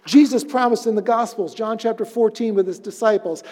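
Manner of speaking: ordinary talk, nothing marked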